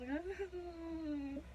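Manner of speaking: high, slow whimpering whine; whining cry. Retold or imitated. In labor, whining at the nurse mid-check, tearful whimper. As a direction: whimper it out like every check hurts. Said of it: "and I was whining".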